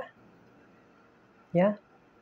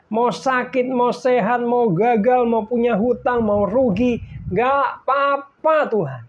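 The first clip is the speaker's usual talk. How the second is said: fervent surrender; 5 semitones higher, 4 dB louder than usual. Retold or imitated. Declaring total surrender to God.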